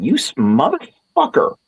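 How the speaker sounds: high, slow mock yelp of a curse; startled curse. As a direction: yelp it like you just got flicked. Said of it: "I just went".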